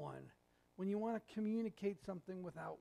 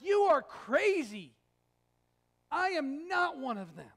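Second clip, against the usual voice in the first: emphatic denial; 6 semitones higher, 10 dB louder than usual. Acted. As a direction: push him away: higher and louder, you are crazy. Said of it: "the most emphatic denial".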